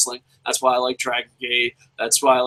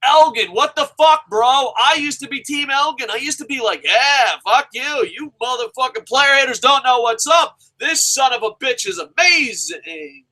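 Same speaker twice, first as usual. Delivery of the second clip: shouting, half-joking exasperation; exasperated rant; 9 semitones higher than usual. Acted. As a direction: yell at him like a betrayed fan. Ranting at Elgin loudly.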